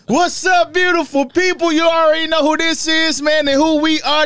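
high, bright host greeting to the listeners; podcast intro. Acted. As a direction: open the show like a hyped host.

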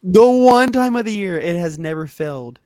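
loud exasperation. Real, not acted. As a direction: drive the complaint home loudly. Exasperated complaint about ice cream machine.

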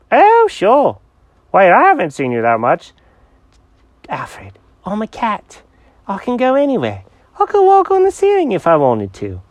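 high, eager cat character; excited cat voice. Acted. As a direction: bounce it out like an excited kitty. Jenkins voice.

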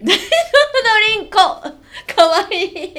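laughing through the words. Real, not acted. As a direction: giggle while saying it. Laughing while speaking.

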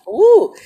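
high emotional woo cry. Real, not acted. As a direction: let out a pained woo. Emotional cry after dedication.

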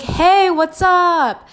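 high, slow, bright hello from the guy friend; cheery greeting. Retold or imitated. Roommate walking in the door, greeting her with a high, slow, cheerful hello. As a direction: sing out a casual hello.

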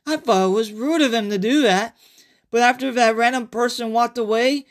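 character narrator. Acted. Survivor greeting listeners before his flashback story. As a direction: play a survivor telling his tale.

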